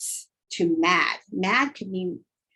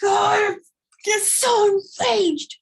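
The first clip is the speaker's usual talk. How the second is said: much higher, loud rage; enraged shout; enraged outburst; 12 semitones higher, 4 dB louder than usual. Shown as a demonstration. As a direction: explode in rage. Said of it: "Sometimes you're enraged".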